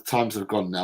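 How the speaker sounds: ordinary delivery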